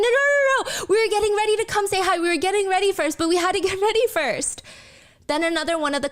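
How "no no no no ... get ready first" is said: hammed-up flustered girls; flustered high protest. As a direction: protest in a rush, voice jumping high. Girls freaking out, waving hands.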